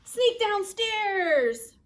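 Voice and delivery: loud, high, very drawn-out reveal; slow, ringing proclamation; dramatic announcement. A narrator's reading. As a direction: proclaim the naughty deed. Announces the forbidden act.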